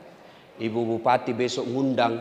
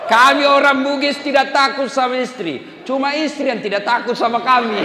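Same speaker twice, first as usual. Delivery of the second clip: higher, louder joking sermon; lively preaching; 12 semitones higher, 8 dB louder than usual. Acted. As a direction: punch the jokes to the crowd.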